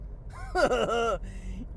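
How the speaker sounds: a wheezy, crying-edged giggle; goofy laugh. Real, not acted. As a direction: laugh until it almost cracks. Signature laugh after a punchline.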